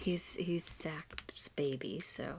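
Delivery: slow, breathy whisper; whispered aside. Real, not acted. Cohost whispers a gossipy aside about Zach's favourite, slow and hushed. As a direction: murmur it like a secret.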